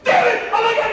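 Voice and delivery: high, loud amazement; excited outburst. Retold or imitated. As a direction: burst in overwhelmed. Bursting into trailer after reading.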